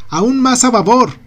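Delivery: high, loud call across the deck; shouting. A narrator's reading. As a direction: shout the order to the helm. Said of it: "grita Ashley".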